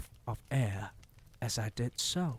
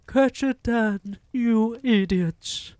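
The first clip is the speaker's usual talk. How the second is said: very high, loud shriek of fury; witch's scream; 12 semitones higher, 11 dB louder than usual. A narrator's reading. Grand High Witch screaming at her witches, shrill fury. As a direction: screech at your useless minions. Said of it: "screamed the Grand High Witch".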